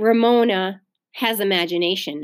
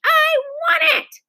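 put-on very high child's shriek; high, raised-voice wail; screamed demand; 4 dB louder than usual. A narrator's reading. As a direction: shriek it like a tantrum. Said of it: "screamed Ramona".

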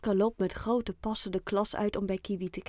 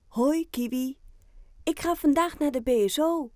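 put-on a boy's voice, higher and a bit louder, chatting to his pet; excited little boy; 6 semitones higher, 4 dB louder than usual. Oscar tells his guinea pig Kiwi about his big day, excited higher boy voice. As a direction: tell your guinea pig the big news. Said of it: "zegt Oscar tegen zijn cavia".

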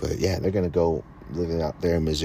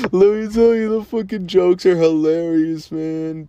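laughing; 10 semitones higher, 6 dB louder than usual. Real, not acted. Still laughing about the jokes.

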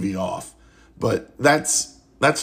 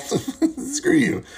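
high, squeaky, laughing "Screw you"; laughing retort. Real, not acted. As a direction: crack up as you say it.

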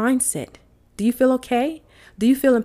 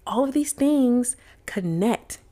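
whisper; 5 semitones higher, slower than usual. Acted. Coach drops to a whisper.

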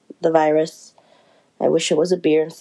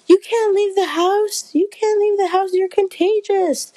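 a much higher, fussing coworker voice; 14 semitones higher than usual. Retold or imitated. Coworker scolding her sick friend, high put-on voice. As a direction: play the worried friend, pitched way up.

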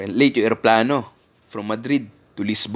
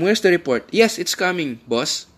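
playful workplace retort, higher voice; mock office comeback; 5 semitones higher than usual. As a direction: turn the question back on the boss. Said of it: "yun ay reply nila".

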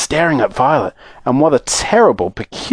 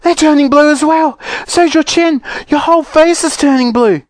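horrified scream; 13 semitones higher than usual. Acted. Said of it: "screamed Missus Beauregard".

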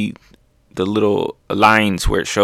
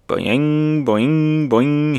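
high, springy "boing" noises; bouncing boings; 5 semitones higher, much slower than usual. Acted. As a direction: imitate a cartoon bounce, ringing each one. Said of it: "Luffy's just bouncing".

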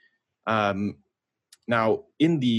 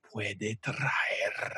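put-on much softer, low seductive Spanish accent with rolled R's; deep sexy Spanish; 9 dB quieter than usual. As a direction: purr it like a suave Spaniard, rolling the R's. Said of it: "deep, sexy Spanish".

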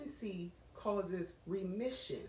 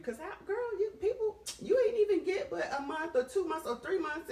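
crying; 10 semitones higher, 3 dB louder than usual. Real, not acted. Tearful about miscarriage grief.

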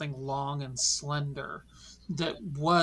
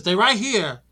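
sudden jump scare; 6 semitones higher, 12 dB louder than usual. Acted. Gerard startles them in vines.

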